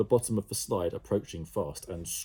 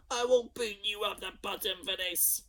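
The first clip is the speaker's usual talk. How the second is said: high threatening sister voice; furious sister; 12 semitones higher than usual. A narrator's reading. Jemima threatening Ned, high and cross. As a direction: spit out the threat. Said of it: "Jemima threatening Ned".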